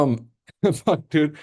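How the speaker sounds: a rueful laughing swear; laughing curse. Real, not acted. Laughing after a botched trade.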